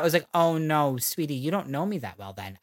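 normal talking voice

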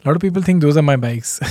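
usual speaking voice

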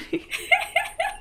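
high squealing laugh; squeal of laughter. Real, not acted. Laughing fit.

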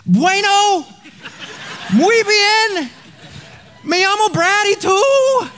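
high, slow gringo Spanish. Retold or imitated. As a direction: play the clumsy American speaking Spanish. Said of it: "I was like".

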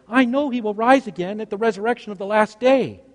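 high-pitched girlish voice playing Martha. Child playing Martha to dad's Jesus, high-pitched. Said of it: "in my Martha voice".

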